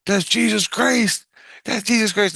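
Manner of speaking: high-pitched mock kids' cry; excited kids calling back. Kids answer the sleeper from above, excited calling. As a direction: call it out high, like children upstairs.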